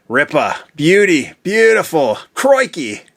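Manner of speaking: playful put-on Australian, rising in pitch; mock Aussie accent. Shown as a demonstration. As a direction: show off a cartoon Australian accent. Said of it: "I already know how to speak Aussie".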